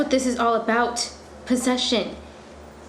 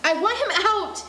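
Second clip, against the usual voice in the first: high, sharp demand; angry outburst; 10 semitones higher than usual. Acted. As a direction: insist she wants him gone. Olive arguing with the police.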